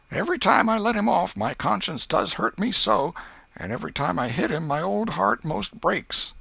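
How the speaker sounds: put-on old aunt grumbling fondly about her boy; Aunt Polly. A narrator's reading.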